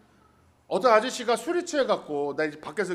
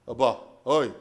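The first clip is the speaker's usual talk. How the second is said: mock menacing call; 10 semitones lower, slower than usual. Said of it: "좀 겁주려고".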